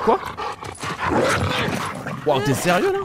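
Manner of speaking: high, loud disbelieving cry; shocked yell. Real, not acted. Dog attack surprise.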